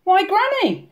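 put-on high, wondering little girl; puzzled girl's high voice; said slowly. A narrator's reading. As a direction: draw out the wonder. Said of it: "she said slowly".

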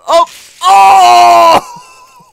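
high, loud drawn-out oh; shocked wail; horrified scream. Real, not acted. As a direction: scream as if watching a gory hit. Character hit by saw.

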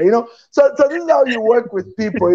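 ordinary talk, nothing marked

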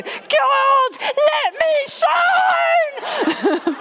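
high, drawn-out laughing shout to the sky; shouted prayer. Retold or imitated. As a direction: yell it out the car window, half laughing. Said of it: "I shouted out the window".

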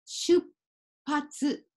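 出発 broken by a silent beat after shu; pause for small tsu. Shown as a demonstration. Teacher repeating the small tsu demo, 出発 with a held silent pause. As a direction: stop short on shup, wait, then patsu for 出発. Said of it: "ここはポーズになりますね".

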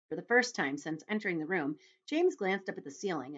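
ordinary delivery

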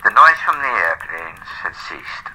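ordinary delivery

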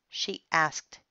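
plain reading voice

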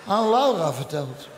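ordinary talk, nothing marked

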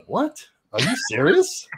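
high, incredulous retold reaction; mock disbelief. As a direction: speaker replies to the accusation, mock disbelief.

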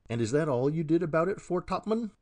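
put-on a mild touch of an irascible old sailor barking; gruff demand. A narrator's reading. Old forecastleman quizzes Billy, gruff demand. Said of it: "gruffly demanded another".

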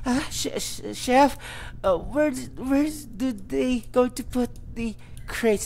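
put-on a frightened girl asking her chef, shaky stammer; shaky, scared. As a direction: stutter out the question, afraid of the chef. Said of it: "shakily approached the chef".

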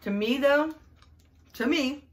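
talking between chews; muffled by food; mouth full, chewing. Real, not acted. Seen 3: Bites bar at -4, chews with closed lips until 3.4.

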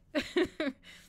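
short, high, loud laugh; real burst of amused laughter. Real, not acted. Reader amused at forgetting her water, loud laughter.

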